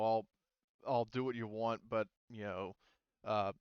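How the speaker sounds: ordinary talk, nothing marked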